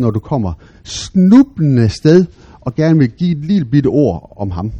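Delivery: ordinary delivery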